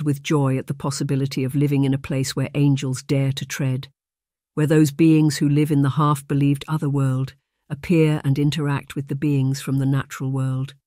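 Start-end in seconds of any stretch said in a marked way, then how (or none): none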